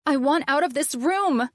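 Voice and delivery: high, loud panicked yell; panicked shout. A narrator's reading. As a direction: scream to be let out.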